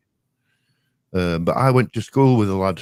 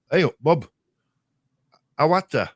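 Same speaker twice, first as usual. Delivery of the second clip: higher-pitched Yorkshire greeting, lightly imitated; Yorkshire dialect; 4 semitones higher than usual. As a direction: hail a mate in broad Yorkshire. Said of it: "you know, Yorkshire dialect".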